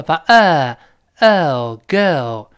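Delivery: put-on "girl" built very slowly from plain vowel plus l, no r; non-rhotic breakdown. Shown as a demonstration. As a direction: stretch "girl" without curling the tongue. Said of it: "字母R不卷舌了".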